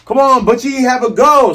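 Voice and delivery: high, loud taunting cat thug; high, brash cartoon taunt. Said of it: "C M says".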